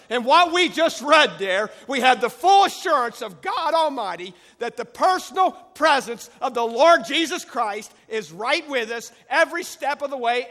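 loud, rising preacher's cry; preaching at full force. Acted. Preacher assuring the congregation God is always there, full-force preaching. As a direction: drive it home to the congregation.

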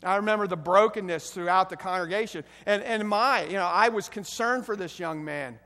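ordinary talk, nothing marked

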